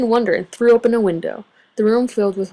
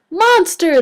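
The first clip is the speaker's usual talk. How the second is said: higher, bright cheer; 9 semitones higher, 4 dB louder than usual. A narrator's reading. The group cheering their monster together, higher and happy. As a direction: cheer it like a crowd of little fans. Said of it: "they all cheered together".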